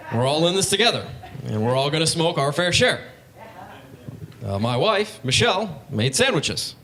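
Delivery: halting presidential speech with swooping emphasis; Obama impression. President offering joints at a peace treaty, halting mock-oratory impression. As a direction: address the delegates in measured, broken phrases. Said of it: "really cool to see him".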